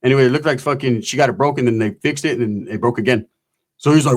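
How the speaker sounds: ordinary talk, nothing marked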